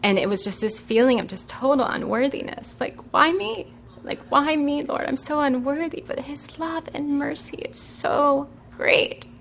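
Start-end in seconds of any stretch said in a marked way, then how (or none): 3.1-6.0 overwhelmed plea. Retold or imitated. Overwhelmed unworthiness before God.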